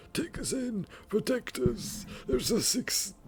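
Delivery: high, pleading crowd cry; desperate refugees wailing to be let in; wailing plea. Acted. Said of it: "implored and wailed".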